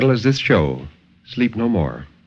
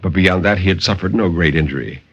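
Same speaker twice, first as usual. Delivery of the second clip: dramatic story narration. A narrator's reading. Narrator reading a horror tale to radio listeners, dramatic and intense. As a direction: tell the horror tale with rising urgency, then settle.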